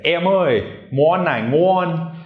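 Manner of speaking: high, drawn-out countryside Vietnamese call; hammed-up rural Nha Quê accent. Shown as a demonstration. Customer walking into a shop, countryside Vietnamese accent. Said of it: "if you talk like that".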